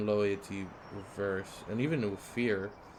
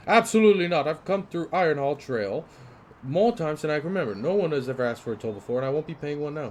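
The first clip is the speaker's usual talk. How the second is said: loud, high, outraged little merchant; fussy merchant refusing to pay; indignant merchant; 5 semitones higher, 7 dB louder, faster than usual. Acted. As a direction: protest the toll at full volume. Angry merchant voiced in game.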